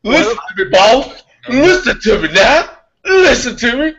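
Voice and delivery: high-pitched, hammed-up insistence; mock insistent lecturer. Imitating someone lecturing the boss on tree nuts, mock insistent. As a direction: press each phrase home in a high voice.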